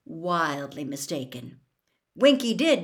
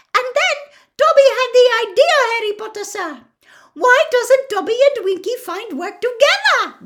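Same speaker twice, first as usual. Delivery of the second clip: high, loud squeaky shouting, fast; shrill shout; 12 dB louder than usual. A narrator's reading. Dobby shouting over Winky's wails, high and loud. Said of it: "shouting shrilly over Winky's screeches".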